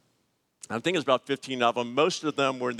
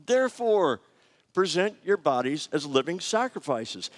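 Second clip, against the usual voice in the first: lightly heightened scripture quote; scripture reading; 5 semitones higher than usual. Shown as a demonstration. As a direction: read the Scripture with weight. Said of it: "he says".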